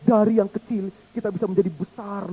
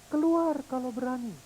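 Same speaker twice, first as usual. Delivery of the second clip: softer, distant repeat; echo of the dare; 5 semitones higher, 5 dB quieter than usual. Pretend. Echo throwing the dare back, soft and distant.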